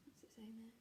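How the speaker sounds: soft whispered question. Real, not acted. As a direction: whisper it to the listeners. Teacher aside to children.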